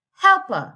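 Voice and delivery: Helper, HEL pitched high, then dropping; first-syllable stress. Shown as a demonstration. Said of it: "The first syllable".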